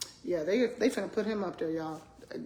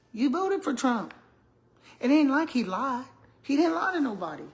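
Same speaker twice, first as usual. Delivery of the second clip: raised, emphatic telling-off; higher, louder, setting them straight; indignant retort; 6 semitones higher, 5 dB louder than usual. Retold or imitated. Speaker retorts to people cussing out Trump, indignant.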